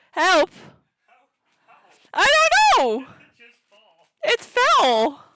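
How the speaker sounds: loud, high cry for help; panicked scream. Real, not acted. Streamer calling for help as the webcam falls, panicked scream. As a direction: shriek it out in real alarm. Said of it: "so sorry if you guys are deaf now".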